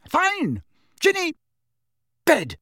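very high, exasperated short cries; shouted order. A narrator's reading. Angry mother sending her daughter to bed, shouting. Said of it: "shouted Missus Weasley".

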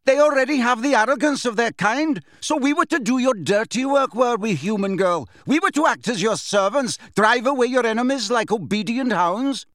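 high, outraged bellowing; furious bellow. A narrator's reading. Grey centaur raging at human girl, bellowing. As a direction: bellow it indignantly, pitched well up. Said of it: "and bellowed".

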